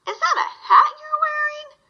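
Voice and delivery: high, laughing dog voice; mocking farm dog. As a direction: tease him like a barking farm dog. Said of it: "she barked".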